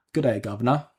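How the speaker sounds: light British greeting, a touch of Cockney; British accent. Pretend. Streamer greets a viewer in a British accent. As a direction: tip the hat in a mild British accent. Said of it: "If we say it more Cockney or British than that".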